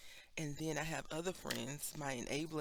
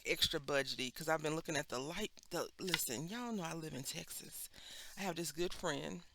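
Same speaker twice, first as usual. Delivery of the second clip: ordinary talk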